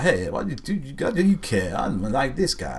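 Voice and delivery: tossing off a cocky line in a put-on Italian accent. Podcast host mocks a sullen hero in Italian accent. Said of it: "That's my Italian accent".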